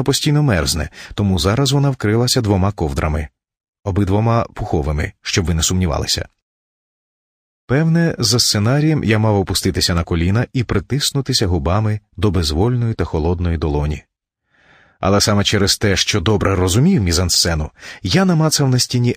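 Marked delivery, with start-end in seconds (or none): none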